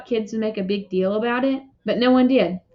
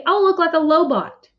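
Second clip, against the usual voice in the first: put-on higher, upset kid's complaint; angry; 7 semitones higher, 3 dB louder than usual. A narrator's reading. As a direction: still angry at mom. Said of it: "I said angrily".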